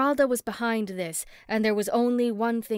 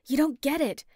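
higher, pressing protest; urgent protest; 6 semitones higher, 3 dB louder than usual. A narrator's reading. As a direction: push back urgently. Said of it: "I protest".

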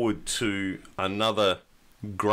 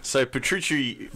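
ordinary delivery

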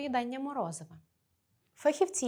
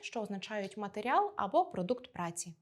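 usual speaking voice

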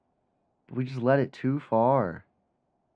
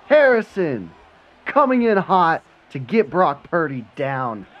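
excited call; 6 semitones higher, 9 dB louder, slower than usual. Real, not acted. Narrating the sack as it happens.

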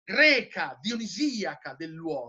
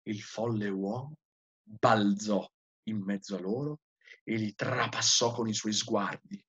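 low, measured dramatic narration; solemn story reading; 10 semitones lower, 4 dB quieter than usual. A narrator's reading. Lecturer narrating the madman facing the crowd, solemn low reading. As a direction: read it low, building suspense.

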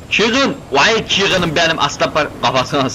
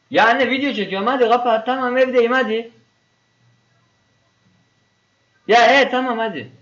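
higher, impatient brush-off to his mother on the phone; brushing off mom; 8 semitones higher than usual. Real, not acted. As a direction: hurry her off the line, mildly annoyed.

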